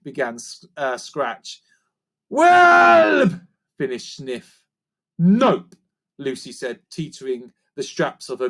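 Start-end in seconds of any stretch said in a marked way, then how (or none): 2.3-3.4 high, loud creature shout; shouted creaker. A narrator's reading. Sniff finishing the line to the others, in a high loud shout. 5.2-5.8 defiant refusal. A narrator's reading. Lucy refuses.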